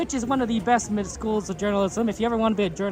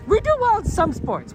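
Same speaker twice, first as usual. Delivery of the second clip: mock boast; 11 semitones higher, 5 dB louder than usual. Repeats the claim with a burst.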